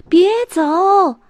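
high, loud yell after a bus; shouting. Acted. Arthur yelling at the departing bus, loud shout. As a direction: call out to stop the bus. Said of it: "亚瑟大声喊".

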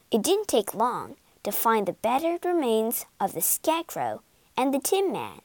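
usual speaking voice

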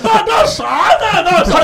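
very high, slow mock shout; mock thug shout. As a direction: ham up the thug's challenge. Voicing the driver mid-story. (高声慢吞吞的假流氓喊话)

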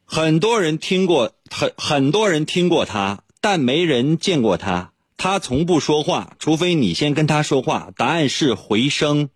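slow, deliberate riddle reading; slow and clear. Shown as a demonstration. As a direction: spell it out slowly for Siri to hear. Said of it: "最后试一下啊". (放慢语速，一字一句地读)

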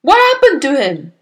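loud, high startled question; spluttering alarm. A narrator's reading. Father jumping at daughter's tale, spluttering. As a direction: blurt it out, a touch panicked. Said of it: "he spluttered".

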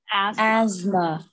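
"asthma" with the S voiced as Z; American pronunciation. Shown as a demonstration. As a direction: say "asthma" as AZ-muh, buzzing the Z. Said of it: "它是S发成Z的声音". (美式读音，"asthma"中的S发成Z)

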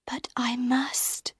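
slow, soft whispered resolve; quietly. A narrator's reading. Christine quietly refusing Raoul's plea. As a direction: breathe it out reluctantly. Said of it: "Christine said quietly".

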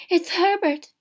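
high, loud cry of recognition; urgent, excited exclamation. A narrator's reading. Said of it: "she cried".

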